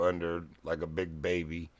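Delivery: ordinary talk, nothing marked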